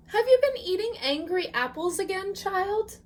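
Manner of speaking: high, quick teasing grandma; grandmother voice. Acted. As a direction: gently tease the child, high. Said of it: "Bubby asked".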